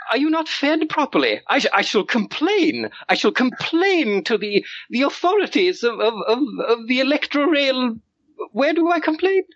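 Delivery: high, indignant little aristocrat; fussing child lord. Acted. Young lord fusses over the hungry guard, high and outraged. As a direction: fret shrilly about the poor fed brute.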